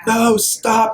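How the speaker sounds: high loud "stop!" in alarm; panicked cry. Retold or imitated. Panicked cry at bleeding watercolor.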